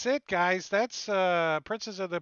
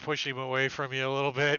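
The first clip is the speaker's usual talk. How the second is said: usual speaking voice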